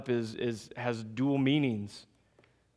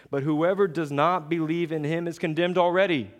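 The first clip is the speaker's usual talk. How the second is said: higher, louder fervent sermon; preaching at full force; 4 semitones higher, 3 dB louder than usual. Acted. Pastor driving home sin and grace: fervent preaching. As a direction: preach it hard to the congregation.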